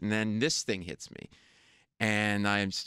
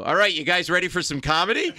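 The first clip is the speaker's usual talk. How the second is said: loud, higher announcer call to the crowd; comedy club MC; 7 semitones higher, 8 dB louder than usual. Retold or imitated. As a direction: hype the room like an emcee. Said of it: "you hear the M C go".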